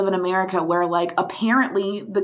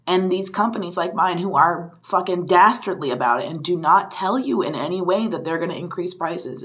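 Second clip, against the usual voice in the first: usual speaking voice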